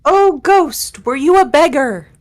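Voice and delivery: loud, theatrical call to a spirit; séance calling. Acted. Asking the ghost about its life, séance calling. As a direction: hail the ghost with swooping, weird solemnity. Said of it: "Why do you say it like that? It sounds so weird".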